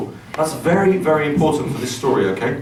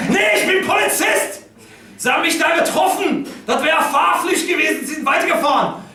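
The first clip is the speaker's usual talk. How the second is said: furious German shout; 12 semitones higher than usual. Retold or imitated. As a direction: yell it like an outraged cop.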